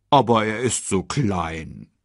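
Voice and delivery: slow, drawn-out pleading insistence; insistent warthog. Acted. Warthog defending the little cub, slow gentle insistence. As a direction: drag it out, mildly pleading. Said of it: "beharrte Pumba".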